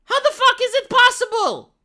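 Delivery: high, loud disbelieving shout; incredulous outburst. Retold or imitated. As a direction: yell it like you can't believe the news. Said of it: "And you're like".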